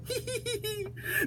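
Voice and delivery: high soft giggle. A narrator's reading. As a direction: giggle quietly. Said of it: "He began to giggle".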